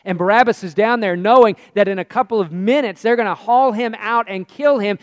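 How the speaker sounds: ordinary delivery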